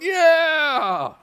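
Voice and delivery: high, loud whooping yeah; crowd cheer. Acted. Writers cheering the raise, loud high yell. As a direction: cheer like a stadium. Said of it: "they all went".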